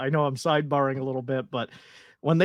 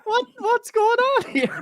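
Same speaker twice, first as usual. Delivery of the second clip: high, slow hammed-up bewilderment; baffled mock reaction; slower than usual. Echoing Ivan's reaction, high slow mock bewilderment. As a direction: stretch it out in stunned disbelief.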